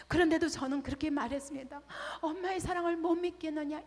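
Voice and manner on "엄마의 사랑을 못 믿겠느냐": reproachful mother. Retold or imitated. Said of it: "이렇게 말했었습니다".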